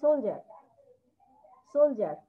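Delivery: সোলজার said clearly as sol-jar, stressed on the first syllable; model pronunciation. Shown as a demonstration. Teacher models সোলজার as sol-jar for a student who asked. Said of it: "three number line আছে সোলজার".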